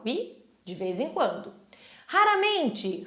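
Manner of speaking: ordinary talk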